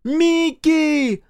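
high, loud drawn-out call; shouted name. A narrator's reading. Parent calls for missing son, shouting his name. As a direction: call out across a wide distance. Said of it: "выкрикивал имена дочери и сына".

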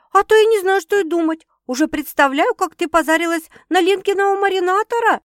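high, loud exasperated teasing; impatient outburst. A narrator's reading. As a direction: let fly, high and loud. Sister exclaims, impatient. (возбуждённо, высоко и громко)

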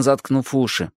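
ordinary delivery

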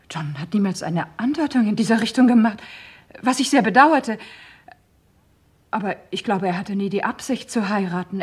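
ordinary talk, nothing marked

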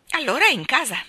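pressing. Acted. Mary Poppins pressing the round woman, insistent and loud. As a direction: corner her with it. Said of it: "incalzò Mary Poppins".

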